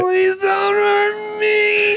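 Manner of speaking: high, very slow shouted beg; fake terrified plea. Villain pretending to be a hostage, slow high wail. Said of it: "just becomes a different person".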